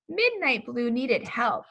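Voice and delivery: plain reading voice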